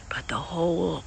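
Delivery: ordinary delivery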